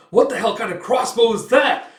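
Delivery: loud, high-pitched disbelief; acted panicked soldier; shocked outcry. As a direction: yell in astonishment mid-battle. Soldier shocked under fire.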